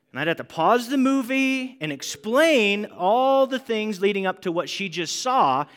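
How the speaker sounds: normal talking voice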